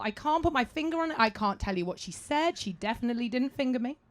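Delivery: normal talking voice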